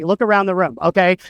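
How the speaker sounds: ordinary talk, nothing marked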